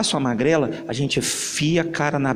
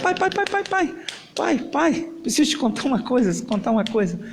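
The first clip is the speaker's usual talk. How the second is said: put-on high eager little boy voice, calling out; a small boy tugging at his dad for attention; child calling dad; 10 semitones higher than usual. Boy runs home eager to tell his father, high and calling.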